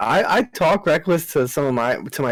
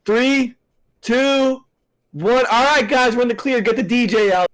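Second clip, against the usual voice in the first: high, raised party countdown call; retold holler, pitched way up; loud as possible; 10 semitones higher than usual. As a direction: announce it so the whole store hears. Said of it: "loud as I can".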